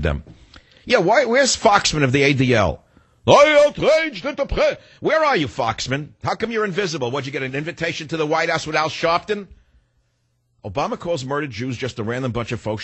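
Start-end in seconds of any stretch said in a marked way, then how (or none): 0.8-9.5 high, outraged taunting; outraged call-out. Real, not acted. Host taunting Foxman on air, outraged high voice. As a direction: jab at him, voice up high. Radio rant calling out Foxman.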